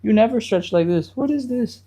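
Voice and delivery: protesting hamstrings. Pretend. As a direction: play a shocked little muscle crying out. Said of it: "Your hamstrings are gonna cry out a little bit and be like".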